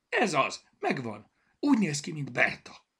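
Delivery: high, loud bursts of excitement; excited discovery. A narrator's reading. Zorka suddenly remembers whom the bear resembles, excitedly. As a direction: blurt it out as a sudden find.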